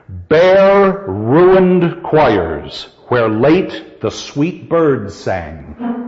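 very slow, measured line of verse; scanning the line. Shown as a demonstration. Teacher gives students a line to scan, very slow and measured. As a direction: draw out each word of the verse, softening toward the end. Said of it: "Scan this line".

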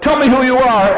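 a nervous guard half-yelling a demand; mock yell. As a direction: call out to the figure in the dark. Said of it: "yells out".

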